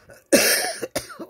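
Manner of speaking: sharp high cough burst; coughing fit. Real, not acted. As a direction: cough hard into the mic. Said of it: "je tousse".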